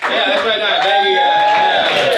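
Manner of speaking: very high, loud shout to the crowd; shouted thanks. Real, not acted. Comic shouting goodbye to the audience, very loud. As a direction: yell thanks as you walk off. Comedian closing set, shouting.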